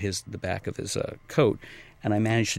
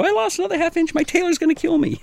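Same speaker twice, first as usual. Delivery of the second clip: very high, louder, squealing mock delight; gushing client; 7 dB louder than usual. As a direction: squeal it like a thrilled client showing off. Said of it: "and go".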